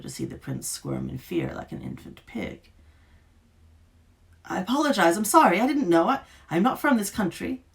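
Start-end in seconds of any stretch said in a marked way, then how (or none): 4.4-7.6 high, loud, panicked apology; frightened protest. A narrator's reading. Duke's son pinned down, protesting in terror. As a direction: plead for your life, voice up high. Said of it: "he protested".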